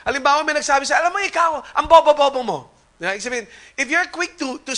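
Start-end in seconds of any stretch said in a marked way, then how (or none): 1.1-2.6 high, loud mock scolding; mock-angry put-down, pitched up; acted insult. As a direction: hurl it like a quarrelsome accuser. Acting out someone insulting you.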